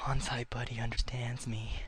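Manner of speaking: soft whispered aside. Acted. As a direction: confide it in a hush. Whispered confession.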